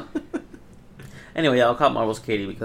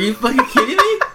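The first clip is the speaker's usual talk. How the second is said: higher, louder incredulous outburst; retold exasperation; 11 semitones higher, 8 dB louder than usual. Recounting finding no app on the TV, exasperated. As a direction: act out loud disbelief.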